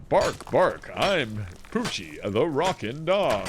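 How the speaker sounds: slow, drawn-out showman's audition; swooping mock announcer voice; Troy McClure. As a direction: ham up each word like a TV presenter. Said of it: "you're just doing Troy McClure's voice still".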